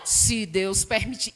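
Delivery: imitating her father.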